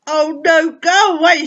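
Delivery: loud, furious shout of go away; angry shout. Acted. Angry boy shouting at the superpower to go away. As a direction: yell it to push them off.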